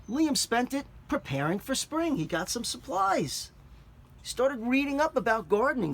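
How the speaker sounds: put-on clear, expressive read-aloud for children; story narration. A narrator's reading. Teacher reads picture book aloud.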